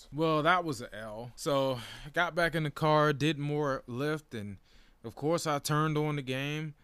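ordinary talk

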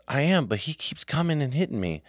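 lightly voiced boy protesting; a light whine of an upset son; imitating his son. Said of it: "And he goes".

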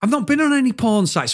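high-pitched indignant protest, retold; fuming denial. Employee denying the director's accusation, acted fuming protest. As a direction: re-enact a heated denial. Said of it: "I proper was fuming".

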